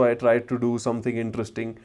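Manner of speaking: ordinary delivery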